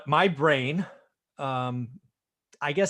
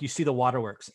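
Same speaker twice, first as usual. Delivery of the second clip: normal talking voice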